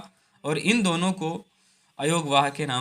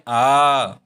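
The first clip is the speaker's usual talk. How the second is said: long vowel demo; 4 semitones lower, 6 dB louder than usual. Shown as a demonstration. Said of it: "उससे दो गुने समय में".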